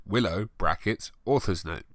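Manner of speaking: ordinary delivery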